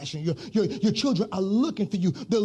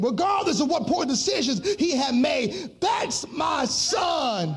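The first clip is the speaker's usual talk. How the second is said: rising, chanted sermon cadence; preaching; 4 semitones higher than usual. Acted. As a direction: preach it, building with each line. Sermon refrain, sung preaching.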